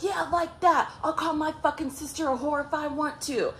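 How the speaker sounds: high mock defiance; retold defiant retort. Ex answers the challenge defiantly.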